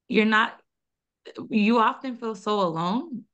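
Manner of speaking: usual speaking voice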